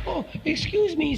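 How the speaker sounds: put-on high, slow, polite child voice; polite young elephant. A narrator's reading. Elephant's child answering the python, high and apologetic. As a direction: lift the voice high to excuse yourself.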